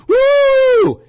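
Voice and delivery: high, loud sarcastic whoop; mock whoop. Host mocking the racism claim, loud sarcastic whoop. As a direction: let out a mocking woo.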